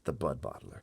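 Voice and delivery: usual speaking voice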